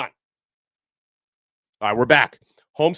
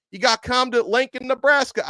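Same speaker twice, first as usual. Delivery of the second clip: much higher, louder mock Midwestern fan voice; hammed-up eager fan begging a recruit; Nebraska Husker fan; 13 semitones higher, 4 dB louder than usual. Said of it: "How do they talk in Nebraska?".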